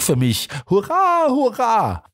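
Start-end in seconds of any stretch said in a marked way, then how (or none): 0.7-1.3 much higher, overjoyed cry of hurra; first hurra shot up high in delight; joyful cheer. Acted. Inspector greets arriving Holmes. 1.3-2.0 higher, jubilant hurra falling away; second cheer. Acted. Inspector greets arriving Holmes.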